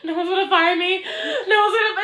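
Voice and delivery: high, loud mock panic; retold freak-out. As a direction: act out mounting panic, half laughing. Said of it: "I almost started freaking out again".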